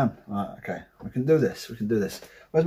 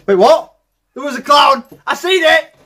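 loud, high excited alarm; alarmed outburst; 8 semitones higher, 10 dB louder than usual. Real, not acted. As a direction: call it out loudly, rising in pitch. Spots a clown after scare.

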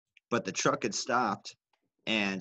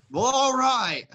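much higher, louder startled all right; thrown-off trucker; 14 semitones higher, 7 dB louder, slower than usual. Retold or imitated. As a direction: caught off guard, stammering then giving in. Trucker caught off guard.

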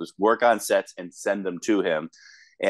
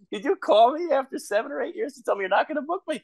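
very high, baffled questioning; incredulous protest. Acted. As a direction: squeal it in comic disbelief. Acts out disbelief at the call.

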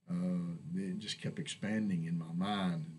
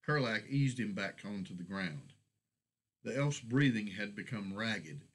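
ordinary delivery, nothing marked

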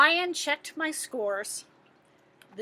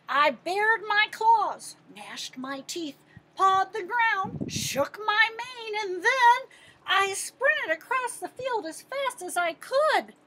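lively read-aloud, higher and louder; excited story narration; 9 semitones higher, 6 dB louder than usual. A narrator's reading. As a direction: read it like the big moment of a picture book.

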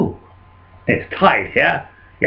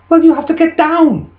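high-pitched, insistent order; bossy farmer's wife; 13 semitones higher than usual. Acted. Wife telling farmer to get down, high and bossy.

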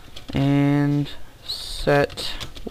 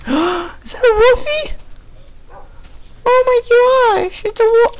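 much higher, loud excited squeal; 6 dB louder than usual. Real, not acted. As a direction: squeal with delight at a puppy. Spots a wolf.

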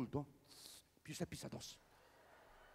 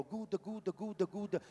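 mock woman moaning; 5 semitones higher, much slower than usual. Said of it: "La donna invece dice".

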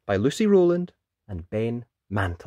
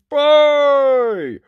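high, loud, drawn-out boom; huge storybook booming syllable; loud giant noise; 14 semitones higher, 12 dB louder than usual. A narrator's reading. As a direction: blast out the giant's first sound. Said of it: "a loud".

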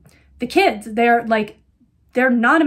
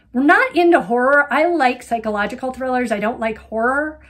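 ordinary delivery

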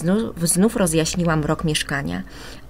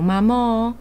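plain reading voice

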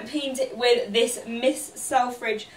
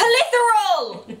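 laughing; 8 semitones higher, 3 dB louder, slower than usual. Shown as a demonstration. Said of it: "If you shout".

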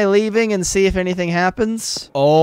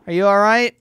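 usual speaking voice

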